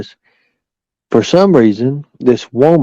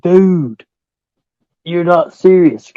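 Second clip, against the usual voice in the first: stunned disbelief; 7 semitones higher, slower than usual. Retold or imitated. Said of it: "I'm sitting here like".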